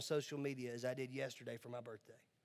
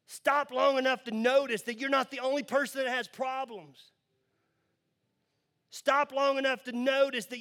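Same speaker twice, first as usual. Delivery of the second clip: building from raised to loud, high preaching; preaching at full force; 9 semitones higher, 8 dB louder than usual. Acted. Preacher urging the congregation to be better, loud. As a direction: push it higher and louder each line.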